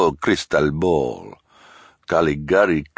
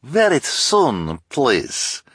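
put-on high, loud foreign accent, drawn out; Madame Lulu's strange accent; 11 semitones higher, 6 dB louder, much slower than usual. A narrator's reading. Fortune-teller answers Olaf's question, in a strange accent. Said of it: "said in her strange accent".

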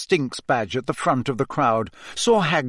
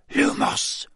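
breathy whispered spell. Acted. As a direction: hiss the spell under your breath. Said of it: "Harry whispered".

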